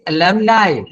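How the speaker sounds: "alumni" ending like "alibi"; teacher's clear model of "alumni", ending "-nai"; pronunciation demo. Shown as a demonstration. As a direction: say "alumni" with final "nai", rhyming with eye. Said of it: "just like alibi".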